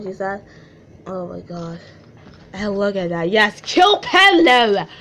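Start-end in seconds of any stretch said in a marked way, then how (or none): 3.3-4.9 loud, high, drawn-out yell; sudden booming outburst; excited shout. Real, not acted. Outburst reacting to the page.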